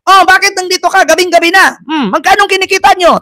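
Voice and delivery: mock high, taunting policewoman; nagbabantang babaeng pulis. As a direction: mimic the bullying cop in a high, prying voice. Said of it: "yung babae na". (ginagayang mataas at nanunuyang boses ng pulis)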